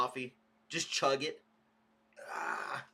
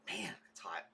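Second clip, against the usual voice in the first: breathy whisper, no voice; whispered complaint; 7 semitones lower, 11 dB quieter than usual. Real, not acted. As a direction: whisper it like you're wilting. Complaining about the heat.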